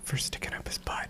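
whispered joke. Real, not acted. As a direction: hush it like a secret. Joking dirty answer.

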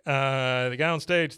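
ordinary talk, nothing marked

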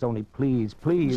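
a lover begging at the door, voice climbing; pleading. Acted. Lover begs to be let in.

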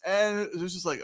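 ordinary talk

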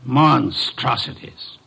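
slow, disdainful single word; scornful. Acted. Detective pronouncing judgment on handbags, slow and disdainful. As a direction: savour your distaste, slowly.

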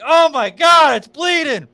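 high, loud panic at the blood; panicked cry. Retold or imitated. Seeing his bleeding leg, a high loud panicked cry. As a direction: yelp it like you just saw blood.

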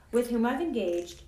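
plain reading voice

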